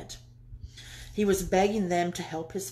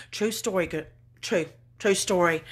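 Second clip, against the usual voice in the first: normal talking voice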